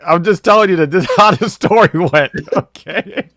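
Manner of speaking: high, quick and breaking into laughter; cracking up while defending yourself; laughing protest. Real, not acted. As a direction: insist through real giggles. Cracks up denying a brag.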